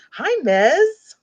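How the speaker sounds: high, loud cheerful hello; cheerful greeting. Real, not acted. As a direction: greet a viewer brightly. Greeting chat viewer.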